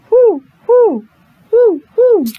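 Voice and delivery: high, loud hooting like an owl; high hoo-hoo owl calls; owl hoots. Shown as a demonstration. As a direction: call out like an owl in the night. Imitating an owl.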